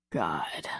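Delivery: low, soft mutter under his breath. A narrator's reading. Man muttering in awe at his lover's body. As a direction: let it slip out low and soft. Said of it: "he muttered".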